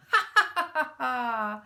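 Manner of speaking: loud helpless laugh; hard laughter. A narrator's reading. Mac laughs at the thieves, hard laughter. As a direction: laugh until tears come. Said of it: "He laughed so hard".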